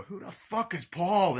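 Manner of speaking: high, loud, slow outraged yell; mock angry guy. Guy in the background yelling about Paul, high and loud. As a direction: bellow it like a jealous boyfriend. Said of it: "you hear the guy go".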